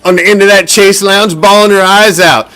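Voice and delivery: normal talking voice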